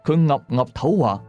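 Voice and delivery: ordinary delivery, nothing marked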